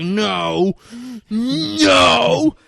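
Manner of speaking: acted high, loud cry of panic; freaking out. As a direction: shout it out in mock panic, building. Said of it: "freaks out".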